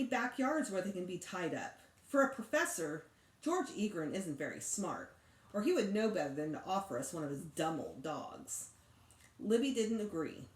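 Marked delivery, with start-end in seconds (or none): none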